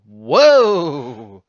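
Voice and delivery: usual speaking voice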